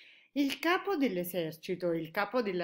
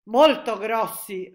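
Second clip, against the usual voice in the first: loud, slow, boastful answer; proud reply; 3 semitones higher, 14 dB louder, slower than usual. A narrator's reading. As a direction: draw it out proudly and firmly. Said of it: "rispose fieramente il capo". (risposta fiera, forte e lenta)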